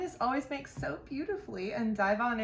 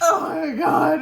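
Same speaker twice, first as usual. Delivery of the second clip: loud delighted cry; excited shout; 6 semitones higher, 9 dB louder, slower than usual. Real, not acted. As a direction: shout it out with glee.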